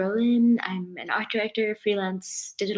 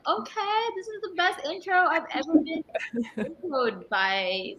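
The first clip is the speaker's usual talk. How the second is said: high-pitched gushing inner voice; excited; 7 semitones higher than usual. Retold or imitated. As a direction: squeal it like you're thrilled. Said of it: "I was like".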